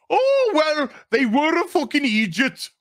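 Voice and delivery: exaggerated mock accent, pitched well up; Lenny Henry. Mimicking an actor joking about the dead, caricatured accent. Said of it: "loves doing a racial stereotype".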